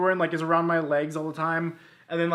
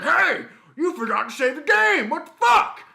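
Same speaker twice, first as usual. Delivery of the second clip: loud, high indignant yell; Mister Resetti; 7 semitones higher, 7 dB louder than usual. Acted. Mole scolds player for not saving, hammed-up angry yell. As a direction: pop up and rant in mock outrage. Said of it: "pops out of the ground and goes like".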